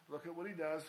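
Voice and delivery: ordinary talk, nothing marked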